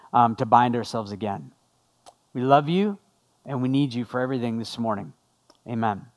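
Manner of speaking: low, measured prayer voice. Real, not acted. Pastor praying to God for the congregation, low and earnest prayer. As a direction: pray in a subdued, conversational low voice.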